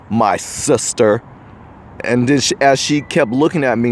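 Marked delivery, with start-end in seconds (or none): 0.1-1.2 slow, pointed my sister, leaning on sister; stress on sister. Shown as a demonstration. Man pointedly calling the woman sister, slow and heavy on sister. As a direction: drag out sister to make it sting. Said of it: "I'm stressing the word sister".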